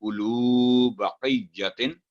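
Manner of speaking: उलू बकैयतिन drawn out with a heavy क; slow recitation. Shown as a demonstration. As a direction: recite slowly. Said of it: "teacher models recitation".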